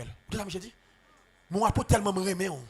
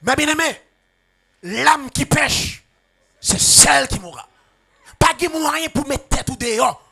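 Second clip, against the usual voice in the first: raised, loud preaching cadence, stretching words out; fervent preaching; 4 semitones higher, 6 dB louder than usual. Acted. Preacher warning the congregation about sin, in a loud fervent preaching voice. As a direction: press the warning home to the flock.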